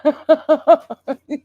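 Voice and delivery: loud, high burst of laughter; real amused cackle. Real, not acted. Dog jumped at the wrong time.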